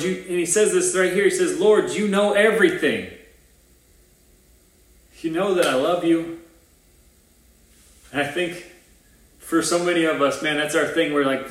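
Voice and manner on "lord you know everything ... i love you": acted frustration. Peter answering Jesus's third question, mildly frustrated. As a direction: plead your case with some frustration. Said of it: "Wouldn't you be frustrated?".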